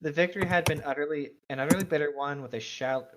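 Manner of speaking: usual speaking voice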